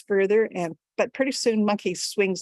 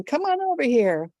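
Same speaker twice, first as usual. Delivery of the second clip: raised, higher storyteller's yell; calling out; 7 semitones higher, 4 dB louder than usual. A narrator's reading. Calling Dragon over from across the lake, a moderate yell. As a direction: call across the distance, not full force. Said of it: "he yells to Dragon".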